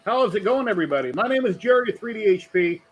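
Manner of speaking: announcer. Acted. Stream intro for raiders.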